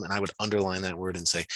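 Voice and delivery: ordinary talk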